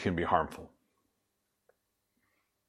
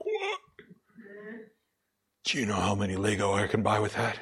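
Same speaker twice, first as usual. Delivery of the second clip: put-on astonished kid; 4 dB quieter than usual. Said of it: "and you're like".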